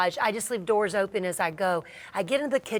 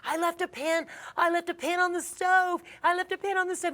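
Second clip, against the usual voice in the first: much higher, urgent re-enactment; retold panicked yelling; 9 semitones higher than usual. Customer explaining fire to nail tech, retold panic. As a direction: repeat it in mock panic, pitched high. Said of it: "I start yelling at my nail tech".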